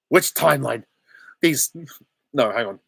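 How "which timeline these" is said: higher, louder comic antihero voice; David Tennant as Deadpool. Acted. Streamer trying a requested Deadpool line, in character. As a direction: start the character impression, loud and raised. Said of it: "David Tennant as Deadpool".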